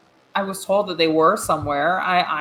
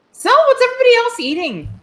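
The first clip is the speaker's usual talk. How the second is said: higher, louder, overly breezy small talk; put-on character voice; 7 dB louder than usual. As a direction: fake casual to change the subject. Player character casual table chat.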